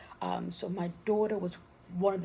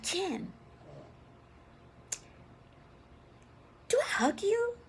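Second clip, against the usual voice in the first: high incredulous question; 12 semitones higher than usual. Retold or imitated. Asking brother about hugging.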